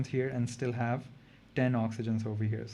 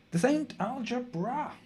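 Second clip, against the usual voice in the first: mock Texan drawl; 10 semitones higher than usual. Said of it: "Say that in a Texan accent".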